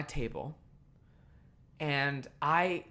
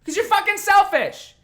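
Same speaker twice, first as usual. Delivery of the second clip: much higher and louder, building to a yell; furious; 14 semitones higher, 14 dB louder than usual. Real, not acted. Accusing the selfish customer, a furious building yell. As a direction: hammer home that she is selfish. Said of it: "But I was fucking angry".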